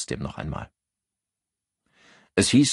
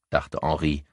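usual speaking voice

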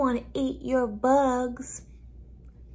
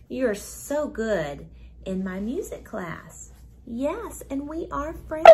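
ordinary delivery

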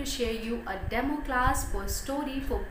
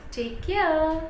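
put-on bright goodbye to little children, a bit louder; cheerful teacher goodbye; 2 semitones higher, 4 dB louder than usual. Shown as a demonstration. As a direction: wave the kids off warmly. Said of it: "this is how you can present yourself".

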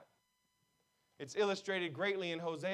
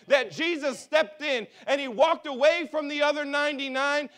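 loud, higher, driving sermon voice; full-force preaching; 5 semitones higher, 7 dB louder than usual. Acted. Pastor pressing the congregation, loud fervent preaching. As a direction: preach it hard to the room.

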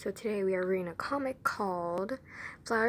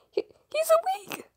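much higher, breathless startled whisper; shocked gasp; much faster than usual. Acted. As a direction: gasp it out in surprise. Character notices he woke.

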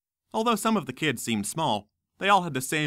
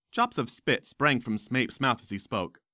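ordinary delivery, nothing marked

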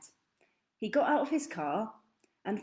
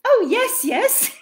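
much higher, loud giggling yes; giggling twins; 12 semitones higher, 9 dB louder, slower than usual. Acted. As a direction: giggle the answer. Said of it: "They both giggled".

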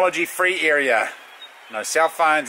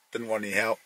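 normal talking voice

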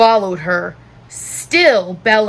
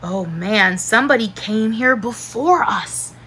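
plain reading voice

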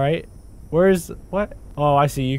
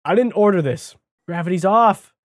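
usual speaking voice

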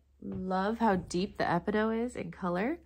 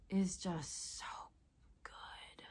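breathy hush of awe; awed whisper; 7 dB quieter, slower than usual. Real, not acted. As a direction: whisper it, lost in admiration. Admiring the crystal.

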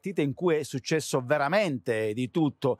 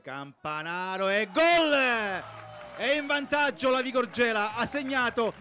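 higher, excited goal announcement; goal call; 7 semitones higher than usual. Acted.

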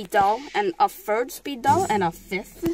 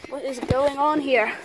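normal talking voice

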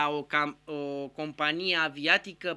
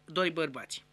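normal talking voice

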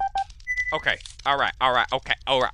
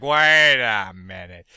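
slow realization; 6 semitones lower, 5 dB louder, much slower than usual. Retold or imitated. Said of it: "I was like".

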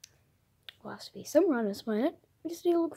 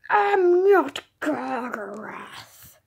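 slow unvoiced villain whisper; slow menacing whisper; 4 dB louder, much slower than usual. Villain whispers to the hero that he is not Gargaroth. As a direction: breathe it out slowly, menacing.

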